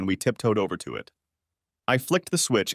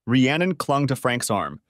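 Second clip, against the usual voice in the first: usual speaking voice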